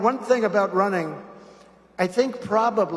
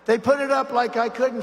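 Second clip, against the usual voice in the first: ordinary talk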